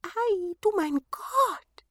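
hoch und gedehnt staunen; staunende Zwerge. A narrator's reading. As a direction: langsam hoch ausrufen. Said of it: "riefen sie". (hohes, gedehntes Staunen)

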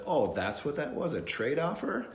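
mock-innocent sarcasm rising high; sarcastic. His own sarcastic reply to the crummy trade offer, mock innocence. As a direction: feign surprise, dripping sarcasm. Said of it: "And I said".

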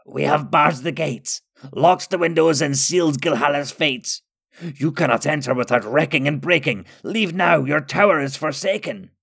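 high-pitched frightened creature voice; terrified. A narrator's reading. Terrified Skurg tells wizards the gates are barred, high panicked cry. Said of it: "The next Skurg that spoke sounded terrified".

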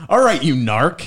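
loud, slow teasing jab; mock scolding. Real, not acted. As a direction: call him a narc, drawn out. Teasing friend.

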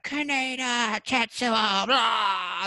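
high, loud anime scream; imitated screaming. Guest re-enacting the transforming scene in Akira, imitated screaming. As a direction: scream the names like agonised cartoon characters. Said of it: "they're screaming back".

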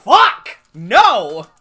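loud frustrated yell; shout. Real, not acted. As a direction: cry out in mock despair. Reacting to pun.

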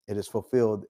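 ordinary delivery

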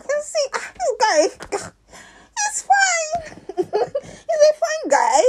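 high, squealing laughter through the words; very high, loud, giddy delight; real giggly gushing. Real, not acted. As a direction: laugh helplessly while admiring someone. Gushing about man's looks, laughing.

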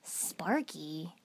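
a hushed, rising "Sparky?" of disbelief; half shocked, half offended at the nickname; surprised outrage. A narrator's reading. Shikamaru reacting to his nickname, hushed indignant surprise. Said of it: "between surprise and outrage".

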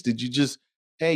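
ordinary delivery

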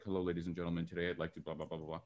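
stiff rehearsed speech, low and soft; practicing a speech. Retold or imitated. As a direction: recite a speech to the mirror. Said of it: "You practice it in front of the mirror".

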